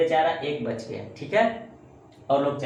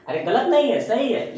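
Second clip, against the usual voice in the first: higher and louder, insisting it's right; emphatic reassurance; 9 semitones higher, 7 dB louder than usual. Real, not acted. Teacher reassuring pupils, raised and louder. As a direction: lift up and reassure, it's not wrong.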